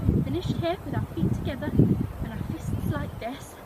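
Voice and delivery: ordinary talk, nothing marked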